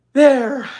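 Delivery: high, loud relieved sigh of a word. Acted. Older sister relieved the story is over. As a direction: finish with relief. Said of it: "she said with relief".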